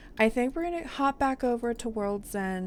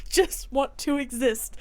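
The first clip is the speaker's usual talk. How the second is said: higher, half-laughing mock cry; playful put-on despair; mock wail; 5 semitones higher, 3 dB louder than usual. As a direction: whine it like a hammed-up sob. Acted quote of her overwhelmed character.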